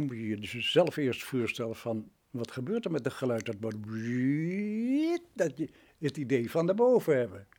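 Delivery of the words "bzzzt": a slow, stretched vocal whoosh of a rocket lifting off; imitating a rocket sound effect; mock rocket whoosh. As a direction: drag the sound out like a launch. Mimicking a rocket going up.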